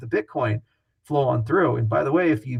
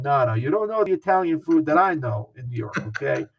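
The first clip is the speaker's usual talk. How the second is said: hammed-up New York Italian swagger; full Italian mode. Founder imitates Scaramucci bragging about New York Italian food, hammed-up. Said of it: "going full Italian mode".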